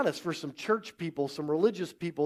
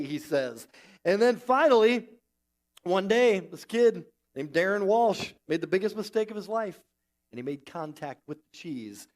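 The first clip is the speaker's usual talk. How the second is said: usual speaking voice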